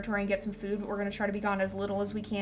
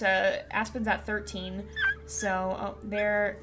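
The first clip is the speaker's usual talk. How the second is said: usual speaking voice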